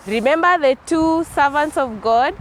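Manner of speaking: ordinary delivery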